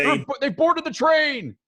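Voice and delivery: high-pitched panicked alarm; screaming guard. Acted. Game master voices guard warning of boarders, screaming. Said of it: "he continues to scream".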